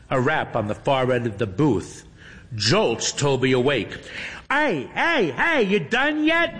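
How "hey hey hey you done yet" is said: high, calling out through a wall; young man calling from outside; muffled young man calling. A narrator's reading. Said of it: "a young man's muffled voice calls out".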